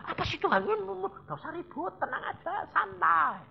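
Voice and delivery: very high, squeaky-pitched imitation; mock fussing. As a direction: imitate a fussy complainer up high. Mimics fussing at God, advises calm. (menirukan rewel dengan nada tinggi)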